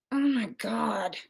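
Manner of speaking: exasperated sigh. Real, not acted. Exasperated by chat troll.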